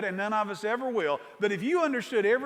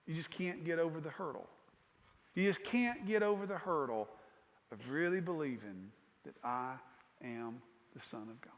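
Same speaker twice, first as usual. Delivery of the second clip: soft lament; 5 semitones lower, 10 dB quieter than usual. Acted. Jesus's lament voiced softly.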